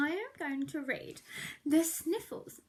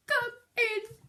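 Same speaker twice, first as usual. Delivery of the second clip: high, louder sick bear rasp; 7 semitones higher, 3 dB louder than usual. Acted. Narrator voicing sick Bear calling in. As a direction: croak it like a poorly bear. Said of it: "he rasped".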